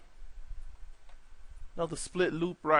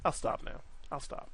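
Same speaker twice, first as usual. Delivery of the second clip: sheepish whisper; 6 dB quieter than usual. Real, not acted. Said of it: "cuts off own punning".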